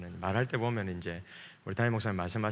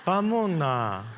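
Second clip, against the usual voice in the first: put-on very slow, coaxing Gyeongsang girl's voice; 애교 있는 경상도 사투리; sweet Gyeongsang dialect; 3 semitones higher, much slower than usual. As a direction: draw it out tenderly in Gyeongsang dialect. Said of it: "안 그런 경상도 사람들도 있습니다".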